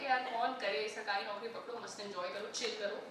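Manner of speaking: low, soft, can't-be-bothered voice; lazy indifference. Acted. Job seeker avoiding anything different, lazily indifferent. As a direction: shrug it off quietly, like a slacker.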